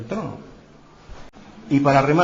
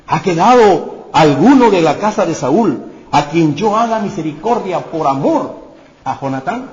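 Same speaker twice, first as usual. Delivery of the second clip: eager royal question; 4 semitones higher, 9 dB louder than usual. Acted. King David asks about Saul's house.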